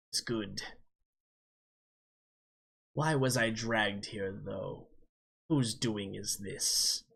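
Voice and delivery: low, slow booming voice with a jester cackle; a gloating demon savoring its return; deep booming demon voice. Acted. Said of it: "this deep booming voice, but it kinda has that, like, jester cackle to it".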